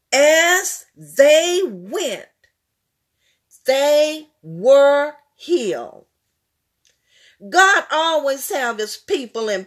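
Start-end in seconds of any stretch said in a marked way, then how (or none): 0.1-6.1 slow and louder, word by word; slow emphatic. Acted. Preacher stressing the lepers were healed as they went. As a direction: land the healing line slowly.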